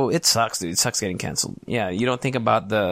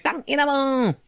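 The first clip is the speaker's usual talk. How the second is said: salty Pinoy gamer. Retold or imitated. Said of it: "if Pinoy".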